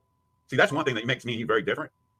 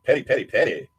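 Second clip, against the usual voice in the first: ordinary talk, nothing marked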